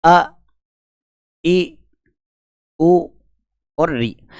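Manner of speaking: 'अ', 'ई', 'उ' said short and crisp; short vowels. Shown as a demonstration. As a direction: clip each short vowel. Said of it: "तो देखो, हमने कहा". ('अ', 'ई', 'उ' छोटे और झटपट बोले)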